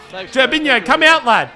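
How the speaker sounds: high, loud shout at the keeper; yelling out. Real, not acted. Player yelling at his keeper to come out, loud shout. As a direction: bellow it across the pitch.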